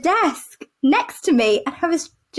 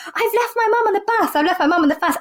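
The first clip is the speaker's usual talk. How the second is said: higher, mildly panicked retelling; mock panic; 6 semitones higher, faster than usual. Daughter ringing the bus company, mock panicked. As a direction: imitate a flustered caller, lightly. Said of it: "And she panicked".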